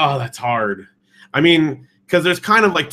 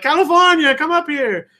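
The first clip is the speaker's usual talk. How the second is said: very high, loud Olive Oyl summons; Shelley Duvall; 14 semitones higher, 4 dB louder than usual. Retold or imitated. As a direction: call a guest up in a squeaky Olive Oyl voice. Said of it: "Shelley Duvall's Olive Oyl talk".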